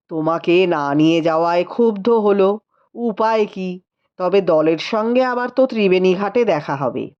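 tired rider brushing off questions; lightly voiced tired husband. A narrator's reading. Rupendra answering the sister-in-law, mildly weary.

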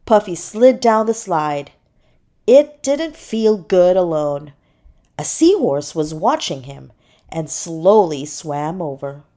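ordinary delivery

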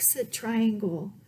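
ordinary delivery, nothing marked

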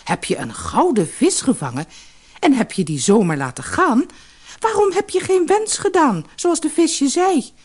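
cross wife demanding an explanation; exclaiming wife. A narrator's reading. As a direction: build to a high, outraged reproach. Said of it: "riep de vissersvrouw uit".

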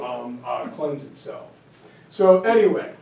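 ordinary talk

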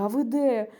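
loud, pressing question; worried urgency. Retold or imitated. Scared caller asks the repairman his whereabouts, loud and urgent. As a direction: demand to know, mildly alarmed. Scared phone call, retold.

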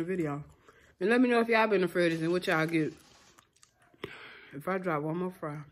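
chewing between words; speaking with food in mouth; talking while eating fries. Real, not acted. Seen 2: Holds fry at lips, bites fries while talking throughout.

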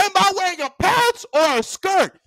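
high, emphatic quiz to the class; hammed-up stern demand; strict teacher. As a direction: imitate a teacher drilling pupils.

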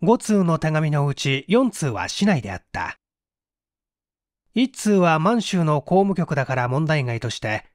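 ordinary delivery

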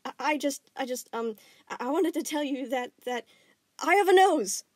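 stammer, then a loud high blurt; nervous blurt. Acted. Nervous boy blurts an odd confession, loud at the end. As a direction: build up and blurt it out.

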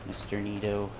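low, soft muttered threats; near whisper, calm. Real, not acted. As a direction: keep it hushed and low to stay calm. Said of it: "I'm sorry if I'm whispering".

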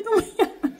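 hammed-up frazzled aunt giggling the plea; panicked aunt. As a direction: repeat it in a mock panic through a laugh.

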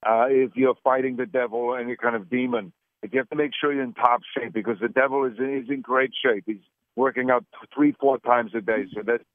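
put-on Austrian accent, Arnold-style; Austrian-accented action star interview voice; Arnold Schwarzenegger impression. Arnold impression held throughout.

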